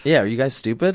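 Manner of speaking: normal talking voice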